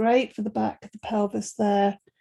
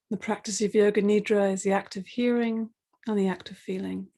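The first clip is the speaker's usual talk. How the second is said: calm, quiet relaxation guide; guided meditation; 3 dB quieter than usual. Acted. Settles class into Yoga Nidra.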